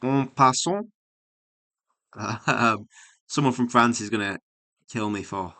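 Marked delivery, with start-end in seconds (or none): 0.0-0.9 "En passant" said ɔ pasɔ, in an overdone French accent; mock French accent on "En passant"; terrible French accent. Shown as a demonstration. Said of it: "saying that with a terrible accent".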